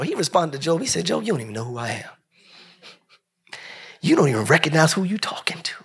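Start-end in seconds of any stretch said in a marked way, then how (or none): 1.0-5.7 low fast hushed rebuke. Acted. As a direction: scold Job under the breath. God rebuking Job, hushed.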